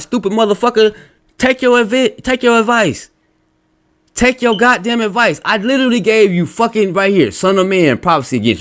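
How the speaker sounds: heated, high-pitched rebuke; real exasperated anger. Real, not acted. Ranting at whoever posted the wrong answer, exasperated. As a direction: scold them in a high, exasperated voice. Angry rant at a rival poster.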